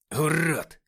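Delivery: furious yell at the enemy; angry shout. A narrator's reading. Nikita yells the insult at Suvor, furious shout. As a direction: spit the insult out loud.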